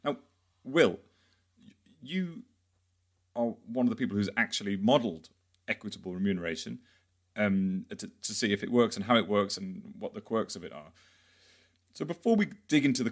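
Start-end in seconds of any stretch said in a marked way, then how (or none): none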